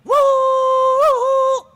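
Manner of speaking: loud, very high drawn-out siren-like wail; imitated baby wail. Days-old baby crying during tea, high imitated wail. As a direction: mimic a newborn crying in long high whoops. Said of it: "અને એમાં છોકરું રોયું".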